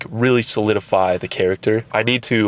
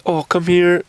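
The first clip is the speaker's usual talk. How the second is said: higher-pitched mock coaxing call; eager coaxing; 8 semitones higher than usual. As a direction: act out calling a cat over, too keenly. Acts out coaxing a cat.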